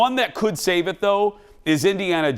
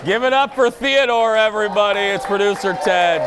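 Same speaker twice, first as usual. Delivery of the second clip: hype announcer call; 5 semitones higher, 4 dB louder than usual. Real, not acted. Host hypes crowd for producer.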